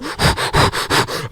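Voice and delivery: noisy exhaled blasts; blowing into mic. Shown as a demonstration. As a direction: demo puffs of breath on the mic. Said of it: "sometimes I just go".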